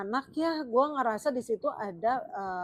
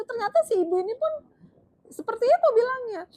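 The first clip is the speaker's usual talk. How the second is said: excited; 12 semitones higher, 8 dB louder than usual. Real, not acted. Excited setup of mother's view.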